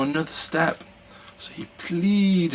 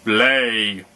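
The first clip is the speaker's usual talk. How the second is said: louder one-word giant command; giant's shout; 6 dB louder than usual. A narrator's reading. Giant orders the hen, louder gruff shout. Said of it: "shouted the giant".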